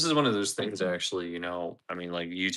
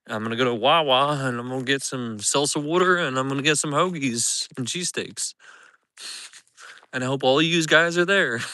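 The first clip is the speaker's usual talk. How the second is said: hammed-up Philly accent, louder; 4 semitones higher, 4 dB louder than usual. Vlogger promising viewers a Philly trip, louder hammed-up Philly accent. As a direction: lay the Philly accent on thick. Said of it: "There's my Philly accent".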